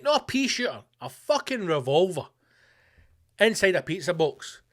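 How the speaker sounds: ordinary talk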